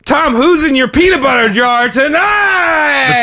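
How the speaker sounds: slow, drawn-out show host call; announcer call. Acted. Cohost opening the podcast, slow booming announcer call. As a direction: announce the guest like a late-night host.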